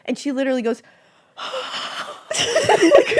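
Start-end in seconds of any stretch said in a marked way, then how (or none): 1.4-2.3 unvoiced, acted shaky breath; imitated breathy gasp. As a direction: let out a mock trembling breath. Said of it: "lets out this, like, shaky".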